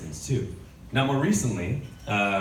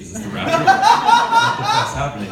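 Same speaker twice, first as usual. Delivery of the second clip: high, loud, slow panicked shriek; rising panicked squeal; mock panic; 12 dB louder than usual. Panicked at car speaker.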